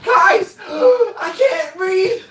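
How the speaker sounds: high, loud mock cry, gasping for air; imitated crying wail. Imitating winded cousin crying to the guys, hammed-up gasping wail. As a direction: wail breathlessly like a winded kid. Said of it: "And then he's just crying".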